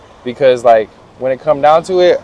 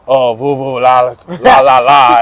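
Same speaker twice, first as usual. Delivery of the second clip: mock French; slower than usual.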